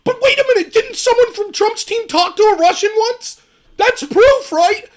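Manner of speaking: high, loud sarcastic mock gasp; sarcastic mock outrage. Host mocks Democrats grasping at straws, high sarcastic voice. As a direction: play the gullible accuser. Mocking opponents' flimsy claim.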